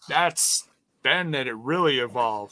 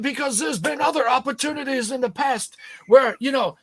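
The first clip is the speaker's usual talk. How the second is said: high, put-on British newsreader accent; British accent, badly faked; horrible British accent; 10 semitones higher, 4 dB louder than usual. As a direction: deliver wrestling news like a bad British pundit. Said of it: "It's my horrible British accent".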